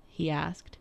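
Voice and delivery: usual speaking voice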